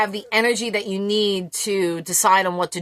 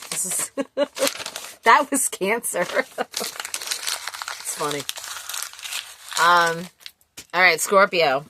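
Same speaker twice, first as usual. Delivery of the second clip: ordinary delivery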